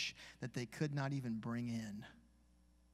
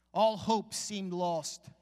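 dramatic slow line; 4 semitones higher, 8 dB louder, much slower than usual. Acted.

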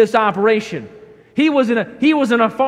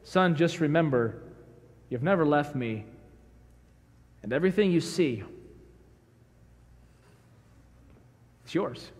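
softer, gentle reassurance to his son; tender father; 5 semitones lower, 6 dB quieter than usual. Acted. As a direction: speak low and kind, like a loving dad. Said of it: "I picture the father saying it like this".

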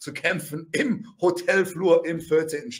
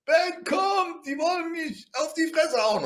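high-pitched imitation of his wife; mock distressed cry to a husband; wife calling for help; 9 semitones higher than usual. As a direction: call out high and urgently, as the wife. Wife calls him for help.

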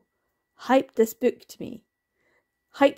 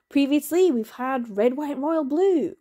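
normal talking voice

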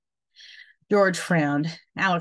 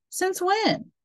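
surprised. A narrator's reading. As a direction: jump up in surprise. George surprised at Louie.

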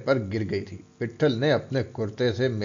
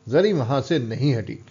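plain reading voice

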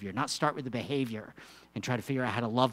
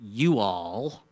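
Texan drawled "you all"; Texan y'all; 3 semitones lower, 5 dB louder than usual. Shown as a demonstration. Preacher doing Paul as a Texan, accent. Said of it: "it's y'all".

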